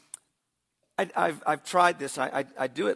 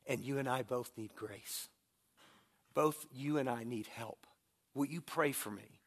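gentle humble appeal; 6 dB quieter than usual. Retold or imitated. Father admits his own anger to his son, soft and humble. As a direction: speak softly, humbly asking your son for help. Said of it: "I was able to say".

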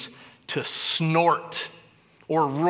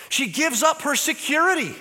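rising fervour; 11 semitones higher, 3 dB louder than usual. Real, not acted. Repeated sermon refrain.

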